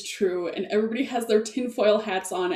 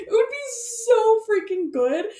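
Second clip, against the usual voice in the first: gushing excitement; 12 semitones higher than usual. Real, not acted. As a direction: savor every word with delight. Repeats with more gusto.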